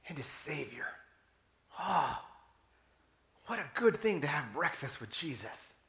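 soft, near-whispered wonder; hushed awe. Real, not acted. Preacher savoring breakfast with Jesus on the shore, hushed awe. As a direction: drop to a reverent hush.

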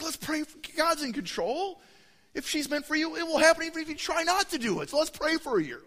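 high, sing-song pastor's counsel; lightly hammed-up wise mentor; mock pious advice. [name] advises his student leader, lightly mock pious.

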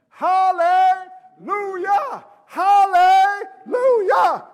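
loud, high, drawn-out shout; ecstatic praise. Acted. Believer praising for all eternity, loud drawn-out exultation. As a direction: stretch each syllable into a slow, exultant cry.

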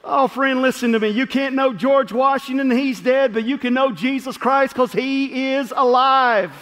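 loud, driving questions from the pulpit; full-force preaching. Acted. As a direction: pound each question home to the congregation. Pastor preaching to congregation.

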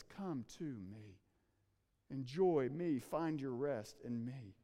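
tender invitation. Acted. Jesus' invitation, hushed.

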